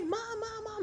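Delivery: put-on kids calling mom. Scared kids calling for their mom, high cries. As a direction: call out like a scared kid.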